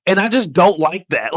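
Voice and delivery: usual speaking voice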